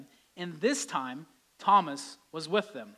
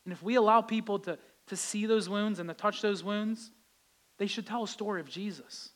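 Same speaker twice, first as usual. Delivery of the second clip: ordinary talk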